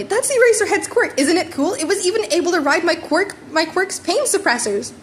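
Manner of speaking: excited fanboy explaining; eager. A narrator's reading. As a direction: gush about the hero's quirk. Eager boy covering for teacher.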